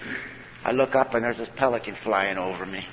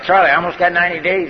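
higher and louder, proud and pleading; eager plea; 7 semitones higher, 7 dB louder than usual. Retold or imitated. Newcomer telling his sponsor about ninety days, eager and raised.

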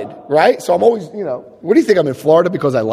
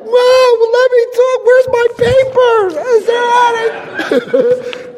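very high, loud panicked squeal; comic panic; 3 dB louder, slower than usual. Retold or imitated. Man pleading with a woman swinging at him, high comic panic. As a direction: squeal your plea as if dodging blows. His panicked plea while being hit.

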